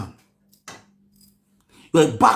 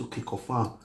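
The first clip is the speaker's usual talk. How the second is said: meek okay; 5 semitones lower, 13 dB quieter than usual. Retold or imitated.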